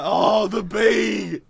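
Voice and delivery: loud, high hammed-up lament; mock wail. Joker undoing their rational claim over a bee, mock wail. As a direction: burst into a pretend outcry of grief.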